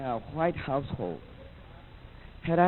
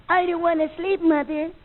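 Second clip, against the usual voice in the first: put-on much higher prissy kid voice; 10 semitones higher than usual. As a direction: protest bedtime like a pampered boy, high.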